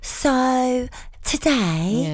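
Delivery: drawn-out influencer uptalk; Instagram story voice. Acted. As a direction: parody an influencer, stretching every word very slowly. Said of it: "people talk like that on their Instagram stories".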